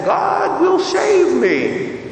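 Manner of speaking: high, slow, stubborn shout; man in the flood yells up at the rescuers, slow high shout; defiant yell. Retold or imitated. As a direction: bellow it back up at the helicopter, dragging it out. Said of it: "the man yells back".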